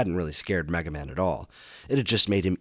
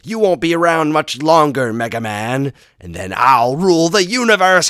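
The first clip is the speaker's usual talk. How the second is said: much higher, much louder villain; megalomaniac rant; 10 semitones higher, 14 dB louder than usual. Acted. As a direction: shout out a mad boast. Wily boasts before laughing.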